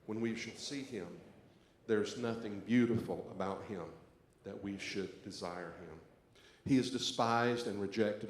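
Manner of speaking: loud, solemn scripture reading for the whole room; responsive reading. Acted. Pastor leading the congregation in the responsive reading, loud and solemn.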